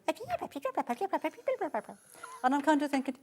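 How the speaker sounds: ordinary talk, nothing marked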